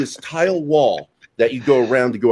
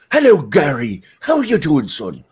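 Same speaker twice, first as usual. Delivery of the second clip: a hint of Dublin Irish, slower at the end; light Irish lilt; lightly Irish priest; 3 semitones higher than usual. Godfather priest phoning Gary, light Irish lilt. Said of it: "he'll call me up and go".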